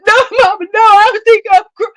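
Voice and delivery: high, loud crying plea; pleading child. Retold or imitated. Child begging mama mid whooping, high crying plea. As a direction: wail a desperate denial.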